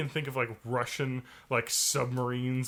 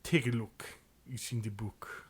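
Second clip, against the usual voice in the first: lower, softer mock Russian accent; low voiced Russian; 4 semitones lower, 8 dB quieter than usual. Friend showing the low-voiced Russian accent. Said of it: "the low voiced Russian".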